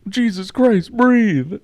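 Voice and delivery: high, loud yell to breathe; hammed-up panic at dad; host yelling at co-host to breathe, mock urgent; mock urgent shout. Urging co-host to breathe, joking.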